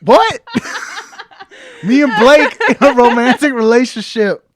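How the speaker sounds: loud, high, laughing disbelief; shocked laugh. Real, not acted. Accused of a crush on Blake, laughing shock. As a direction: squeal it in outrage and delight.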